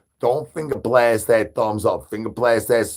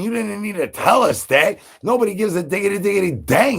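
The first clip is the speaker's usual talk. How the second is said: mock indignation; 10 semitones higher, faster than usual. Mock outrage at chat.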